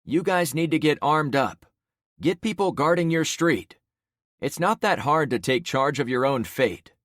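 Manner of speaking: rally the crowd to act; commanding. Acted. Protagonist urges crowd.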